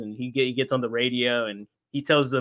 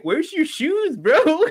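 amused stranger's voice; 12 semitones higher, slower than usual. Retold or imitated. Imitating racer asking about shoes.

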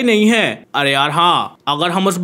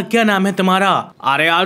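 normal talking voice

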